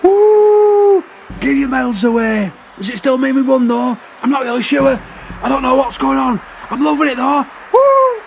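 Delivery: high-pitched excited whoops and shouts; elated crowd cheering. Acted. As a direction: cheer wildly, high and confused but loving it.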